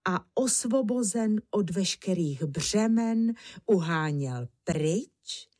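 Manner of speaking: ordinary delivery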